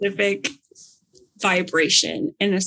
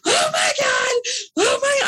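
freaking out. Retold or imitated. Retelling finding out about the business class upgrade, shrieking with excitement. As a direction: squeal it out, overjoyed and shocked. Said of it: "I'm, like, freaking out".

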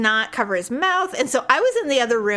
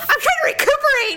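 much higher, louder strained shout; 4 dB louder than usual. Retold or imitated. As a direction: yell it through a cough. Husband yells back mid-argument.

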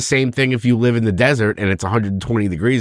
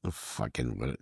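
usual speaking voice

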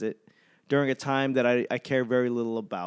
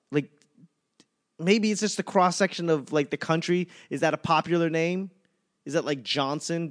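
normal talking voice

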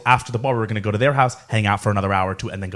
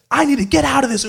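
much higher-pitched mock panic; frantic outburst; 13 semitones higher than usual. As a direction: imitate a drunk friend's high-pitched outburst. Friend's outburst in retold fight.

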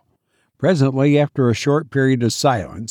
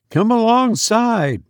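high-pitched hailing call; exclaiming; 10 semitones higher than usual. A narrator's reading. Will invites the stranger alongside, exclaiming. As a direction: call the boat over. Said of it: "exclaimed Will".

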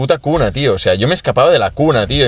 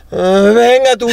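high, loud mocking taunt; taunting kid; 12 semitones higher, 4 dB louder, slower than usual. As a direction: tease the crying boy from the bike. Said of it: "hacía". (burla aguda de niño)

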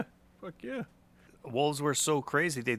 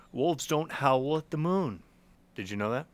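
usual speaking voice

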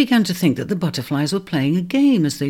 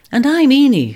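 higher, louder, a little out of breath; slightly breathless; 9 semitones higher, 6 dB louder than usual. Acted. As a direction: pant it after the tumble. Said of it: "slightly breathless".

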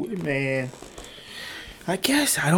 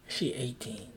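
normal talking voice

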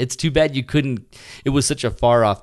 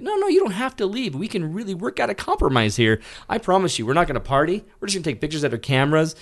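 high, eager pleading; 7 semitones higher than usual. Retold or imitated. Photographer begging the neighbour not to leave, high pleading.

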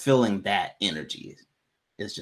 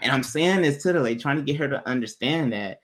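ordinary talk, nothing marked

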